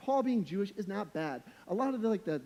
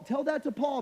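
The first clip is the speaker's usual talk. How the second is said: ordinary talk, nothing marked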